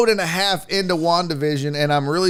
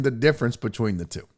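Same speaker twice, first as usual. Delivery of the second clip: usual speaking voice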